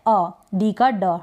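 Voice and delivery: ordinary talk, nothing marked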